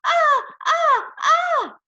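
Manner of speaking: high, loud screams in bursts; piercingly high short screams; scariest scream. A narrator's reading. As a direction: scream to make someone jump. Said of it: "I screamed".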